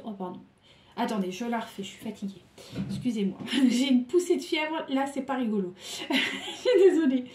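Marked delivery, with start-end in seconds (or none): 2.9-7.2 giggly, louder apology; laughing apology. Real, not acted. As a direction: laugh through the sorry. Laughing through a fever.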